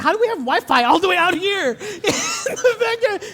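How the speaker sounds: loud, high mock disbelief with a laugh; incredulous. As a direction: act amazed at backyard wifi. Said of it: "I'm like".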